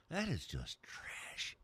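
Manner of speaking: hushed whisper, voice dropped to breath; slow confiding whisper, half mock. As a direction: lean in and whisper it like gossip. Confiding dig at rival dumpsters.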